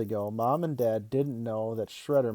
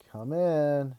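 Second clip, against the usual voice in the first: raised, drawn call; 4 semitones higher than usual. A narrator's reading. Kid calls to his knocking dad, calling out with an eye-roll. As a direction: call through to someone with mild impatience. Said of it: "rolled my eyes and called".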